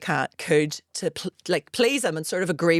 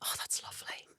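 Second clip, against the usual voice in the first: whispered admiration; 17 dB quieter than usual. Retold or imitated. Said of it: "pulled it up and went".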